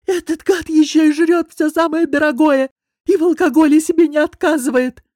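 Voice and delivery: high, loud squeaky croak of a voice giving out; cartoonish wheeze. A narrator's reading. Boss complaining after yelling himself hoarse, cartoonish wheeze. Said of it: "просипел почти мультяшным голосом".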